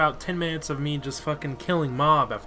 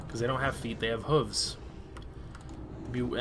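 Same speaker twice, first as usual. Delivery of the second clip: usual speaking voice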